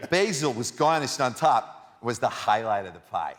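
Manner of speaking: outraged Brooklyn local shouting down fancy toppings; Brooklyn accent. Shown as a demonstration. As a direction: ham up a Brooklyn pizza critic. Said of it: "read it with a Brooklyn accent".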